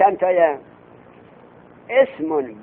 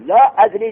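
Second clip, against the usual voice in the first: لا ادری said loud and higher, punched; stress on لا ادری; 4 semitones higher, 6 dB louder than usual. Shown as a demonstration. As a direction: punch لا ادری as the subject of the sentence. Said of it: "جمله مبتدایه همش".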